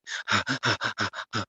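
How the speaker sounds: short tight breath, caught and strained; tense held breath. Shown as a demonstration. Breathwork guide showing the old anxious way of breathing, tight strained breath. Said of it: "now you're not breathing like".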